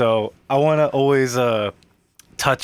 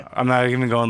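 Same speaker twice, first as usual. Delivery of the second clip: ordinary talk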